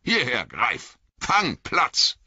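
high, short called orders; calling commands to dogs. A narrator's reading. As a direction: call the dogs to heel. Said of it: "rief er".

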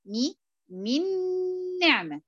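"من نعمة" with "مي" kasra, noon merged and held nasal; teacher demo of "من نعمة" with ghunna; nasal doubled noon. Shown as a demonstration. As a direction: stretch "مي" then glide into "نعمة" with a held ghunna. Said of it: "فلما أجي أقرأها أقول إيه؟".

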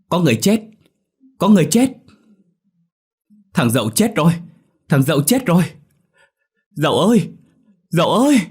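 high, loud terrified screams; screaming in panic. A narrator's reading. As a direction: shriek the alarm over and over. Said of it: "đồng loạt thét lớn".

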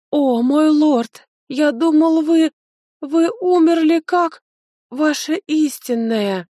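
high, loud, halting outburst; agitated servant. A narrator's reading. Servant to his revived lord, high agitated outburst. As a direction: gush in a high, overwrought voice.

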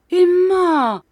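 high, loud, drawn-out cry; alarmed exclamation. A narrator's reading. Husband rushes into his wife's room, crying out in alarm. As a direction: cry out to a loved one, pitch falling at the end. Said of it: "s'écria monsieur Micawber en se précipitant".

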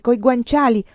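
ordinary delivery, nothing marked

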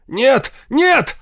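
громко и высоко, в отчаянии; кричи вслед уезжающей машине; отчаянный крик. A narrator's reading. (отчаянное «Нет!»)

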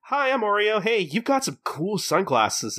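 high, sweet imitation of a hostess; perky hostess. Hostess introduces herself to a customer, perky.

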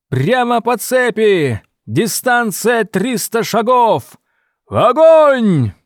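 shouted battle command, high and loud; combat callout. Acted. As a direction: bark the order to open fire. Officer orders fire in battle.

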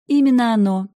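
high, loud bold bluff; confident bluff. Acted. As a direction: blurt it out confidently. Heroine bluffing loudly.

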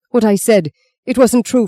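high, loud urgent plea; desperate retraction. A narrator's reading. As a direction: plead to take it back.

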